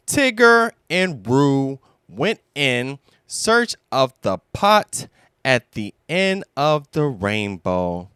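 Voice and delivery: ordinary delivery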